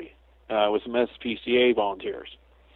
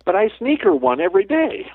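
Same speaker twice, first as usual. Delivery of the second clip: sheepish confession; 11 semitones higher than usual. Retold or imitated. The man confesses a secret treat.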